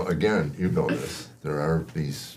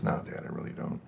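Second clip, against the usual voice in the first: quiet; 8 semitones lower, 13 dB quieter, faster than usual. Retold or imitated. Son quietly admits to dad.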